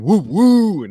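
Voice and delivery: loud high train-whistle hoot; train whistle woo. Retold or imitated. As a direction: hoot like a steam train, excited. Hyping the Allegri hype train.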